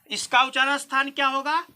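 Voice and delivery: ordinary delivery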